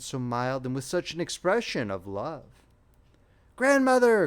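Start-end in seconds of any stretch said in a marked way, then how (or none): 3.5-4.3 put-on high, loud cry of a desperate little girl; a child crying out high and loud to her vanishing grandmother. A narrator's reading. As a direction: call out to the grandmother, pleading. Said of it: "cried the little one".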